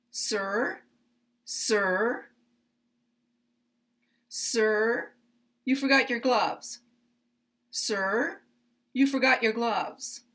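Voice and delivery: "Sir" lifted with a rising tune, then a clear pause before the rest; each "sir" rises like a call for attention, pause, then the gloves line. Shown as a demonstration. Calling to a man leaving the bus who forgot his gloves, rising on "sir". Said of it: "I put rising intonation on sir".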